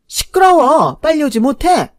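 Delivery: very loud, high maternal command; angry shout. A narrator's reading. Mother cutting off her protesting son, angry shouted order. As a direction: cut him off with a shout. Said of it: "남친 어머니 기세는 정말 대단했어요".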